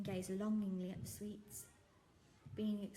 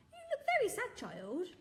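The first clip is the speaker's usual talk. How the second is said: high, louder caring voice; kindly shopkeeper; 12 semitones higher, 6 dB louder than usual. Acted. Shopkeeper noticing the sad girl, high and caring. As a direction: coax her gently like a kind uncle. Raj consoling.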